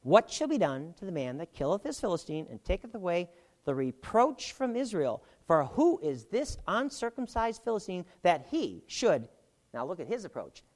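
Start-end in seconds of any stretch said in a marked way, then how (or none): 0.0-9.3 raised, declaiming Bible reading voice; scripture reading. Acted. Teacher reading Jesse's words to the class, declaiming. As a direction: proclaim the verse to the room.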